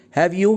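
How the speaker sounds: "have you?" lifted high at the end as a real question; rising question tag. Shown as a demonstration. Teacher demonstrating a real question about seeing Lisa, "have you" rising high. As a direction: let "have you" climb upward, asking for information. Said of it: "if the voice goes up in the question tag".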